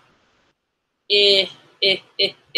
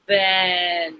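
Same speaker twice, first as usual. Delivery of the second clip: said slowly. Shown as a demonstration. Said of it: "say it slow".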